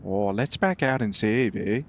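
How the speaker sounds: a retry at a Canadian, low and relaxed; Canadian accent. Pretend. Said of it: "It went a bit, like, weird there".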